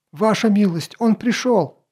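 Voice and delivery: high, urgent servant's yell. Acted. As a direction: keep shouting in alarm. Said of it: "продолжал орать слуга".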